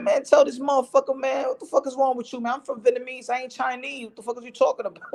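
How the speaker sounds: fired up, insulted, demanding answers; offended friend. Retold or imitated. Imitating the Vietnamese friend telling off the dude, high and indignant. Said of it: "He said".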